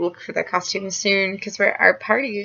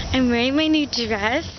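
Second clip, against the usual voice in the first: usual speaking voice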